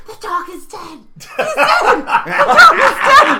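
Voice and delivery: high, loud laughing chant; girlfriend heckling. Retold or imitated. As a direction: crack up while spoiling the punchline. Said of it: "she's in the background just going".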